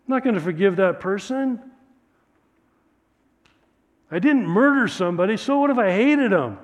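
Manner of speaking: high, loud, self-justifying protest; defiant excuses. Acted. Congregation's defiant voice toward God.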